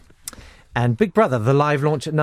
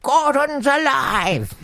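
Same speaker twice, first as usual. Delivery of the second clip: high, very slow mock-royal catchphrase; prim monarch attempting a heroic cry; the Queen; 11 semitones higher, much slower than usual. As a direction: draw out the catchphrase as a regal lady would. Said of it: "the Queen continued".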